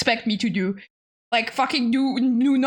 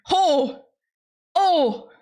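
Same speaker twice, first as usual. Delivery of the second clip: choking on pepper; 5 semitones higher than usual. Real, not acted. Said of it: "Oh, I'm crying".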